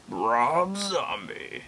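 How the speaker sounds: very slow, swooping delivery of the name; drawn-out eerie name call. Acted. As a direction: stretch it out at normal volume, theatrically. Redoes the name another way.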